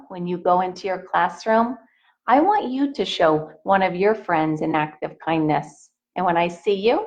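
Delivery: ordinary delivery, nothing marked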